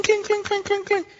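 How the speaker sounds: high, bright imitated coin clinks; sing-song imitation of coins hitting the floor; coins clinking. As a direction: ring out each clink like a coin landing. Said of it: "las monedas hacen clink".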